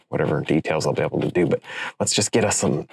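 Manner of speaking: normal talking voice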